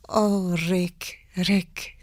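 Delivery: slow, soft Bergman, crisp consonants; lightly voiced Swedish film star; Ingrid Bergman voice. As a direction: deliver it slowly like Bergman, ends of words clear. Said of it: "very slow with consonants".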